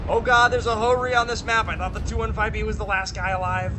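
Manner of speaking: real startled yell, high and loud; alarmed shout. Real, not acted. Startled by an unexpected enemy tank.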